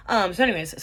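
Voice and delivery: ordinary talk, nothing marked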